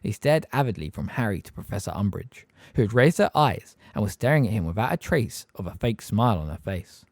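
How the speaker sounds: ordinary delivery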